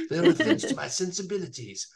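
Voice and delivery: lightly mocked posh British accent; a hint of a refined British villain; Darth Maul. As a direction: put on a posh British accent, half-laughing. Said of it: "this British accent where he sounded like so posh".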